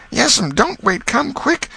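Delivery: high, urgent cry for help; a frightened boy calling out; alarmed boy. Acted. Sid calling his aunt in alarm, high and urgent.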